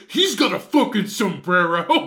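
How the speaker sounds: loud, drawn-out mock outrage; imitated pissed-off outburst. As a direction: mimic someone pissed off, stretching it out loudly. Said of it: "But you were pissed. You're just like".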